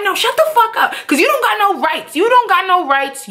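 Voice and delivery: high-pitched, insistent telling-off; angry retort. Retold or imitated. Girlfriend answering boyfriend's yelling, angry retort. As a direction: act out shutting him down mid-argument.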